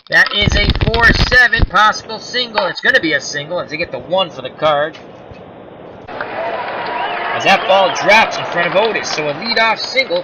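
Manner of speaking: rising excited broadcaster call; play-by-play call. Acted. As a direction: call the hit like a live announcer. Calls the play live.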